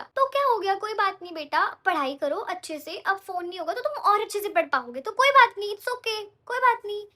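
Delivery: put-on high, loud, soothing mother telling her child it's fine; mom reassuring. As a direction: comfort the daughter like a sweet mom. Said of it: "मेरी mummy ने पता क्या बोला". (प्यार से समझाती माँ की ऊँची, ज़ोरदार आवाज़)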